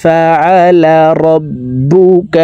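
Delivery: high, slow tajweed chant; sustained melodic recitation, long final vowel; Quran recitation. Shown as a demonstration. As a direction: recite the full verse for learners to follow. সম্পূর্ণ আয়াত বলে দিচ্ছি.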